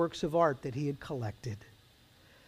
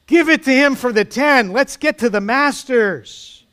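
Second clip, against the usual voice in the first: high, loud shout, slowing at the end; impatient heckle; 7 semitones higher, 6 dB louder than usual. A narrator's reading. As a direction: shout down the auctioneer. Impatient bidder heckles the auctioneer.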